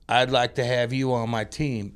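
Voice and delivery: slow, badly missed Boston accent; botched Boston accent. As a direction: attempt his Boston accent, landing somewhere Southern. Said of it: "he's got that Boston accent".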